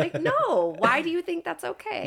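high incredulous no, why do you think that's okay; outraged retort. Retold or imitated. Answering a groper in a club, high and incredulous. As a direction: push back high, in disbelief.

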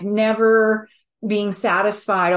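ordinary talk, nothing marked

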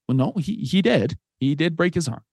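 ordinary delivery

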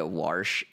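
"warsh" with an r slipped in before the sh; warsh for wash. Shown as a demonstration. Showing friends the Buffalo word, r-colored warsh. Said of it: "wash turns into warsh".

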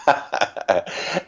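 a soft breathy laugh, rising high; quiet rising chuckle at the memory; airy laugh. Real, not acted. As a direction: laugh it out under the breath, amused. Laughs at mother's book report rule.

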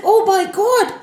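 high-pitched, elated self-congratulation; overjoyed boasting. A narrator's reading. Narad Muni marvels at his own power, overjoyed. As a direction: crow with delight at your own strength. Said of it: "He was so happy".

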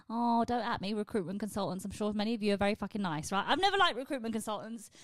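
ordinary delivery

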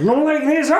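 very high, strained squealing scream. Acted. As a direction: act out an overexcited fan screaming. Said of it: "And just starts screaming".

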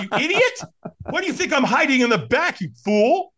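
high-pitched mock outrage; indignant retort. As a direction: scold him in comic disbelief. Acted indignant inner retort to stranger.

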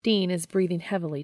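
ordinary delivery

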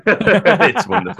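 high, loud laughing speech. Real, not acted. Podcaster delighted by a wrestling moment, laughing. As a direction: break up laughing on the words.

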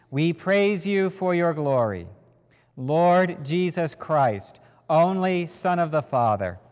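ordinary talk, nothing marked

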